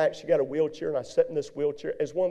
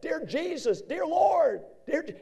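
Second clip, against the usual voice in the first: much higher, strained cries of "Dear Jesus, dear Lord"; desperate prayer in pain; slower than usual. Retold or imitated. Preacher in agony on the way to hospital, crying out high. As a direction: cry out to God through the pain, voice pitched way up. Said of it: "he knew his name was Jesus".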